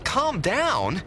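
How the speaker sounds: high, indignant question; incredulous echo. Acted. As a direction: throw the words back in disbelief. Reporter indignantly echoes being told off.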